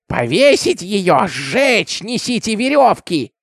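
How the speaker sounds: high, slow, strained baying cries; bay for blood, each cry drawn out; mob shouts. A narrator's reading. Crowd demands ropes and burning, strained high shouts.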